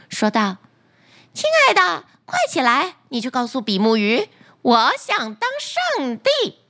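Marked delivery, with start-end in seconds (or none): 1.3-6.6 demanding wife. Acted. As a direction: wake him with a greedy demand. Wife wakes husband.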